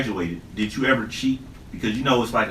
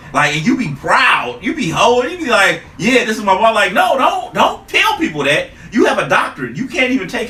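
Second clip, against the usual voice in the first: rising rant; 9 semitones higher, 5 dB louder than usual. Real, not acted. Ranting about friend's boyfriend.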